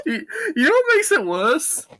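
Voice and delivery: high, squeaky talk through laughter; helpless laughing. Real, not acted. Friend adding a worse fact, cracking up. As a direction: barely get it out for laughing.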